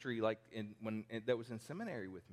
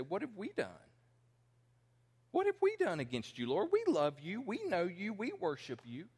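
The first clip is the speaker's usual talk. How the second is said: indignant protest; 9 semitones higher, 4 dB louder than usual. Retold or imitated. Israel protesting innocence to God.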